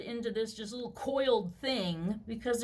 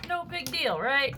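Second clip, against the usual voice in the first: ordinary talk, nothing marked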